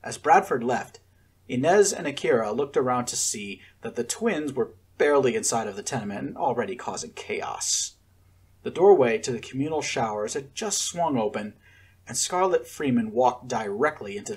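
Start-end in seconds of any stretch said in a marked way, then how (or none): none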